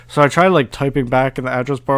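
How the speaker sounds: ordinary talk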